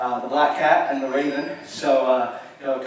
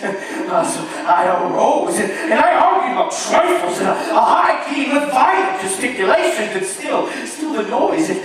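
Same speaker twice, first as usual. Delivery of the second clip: loud, higher, building frenzy; frenzied narrator; 11 semitones higher, 4 dB louder, slower than usual. Acted. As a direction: rant as guilt takes over.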